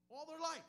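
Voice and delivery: high, loud preacher punch; emphatic punch. Real, not acted. Preacher stressing that they fished their whole life, loud and high. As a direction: hammer the phrase home.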